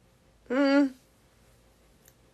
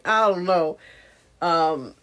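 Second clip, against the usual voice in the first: usual speaking voice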